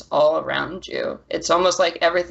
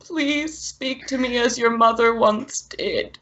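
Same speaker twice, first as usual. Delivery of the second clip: a desperate otherworldly plea, pitched high; pleading spirit; 10 semitones higher than usual. Acted. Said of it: "the voice of your mother over and over again".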